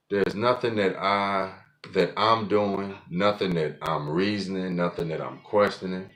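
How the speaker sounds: usual speaking voice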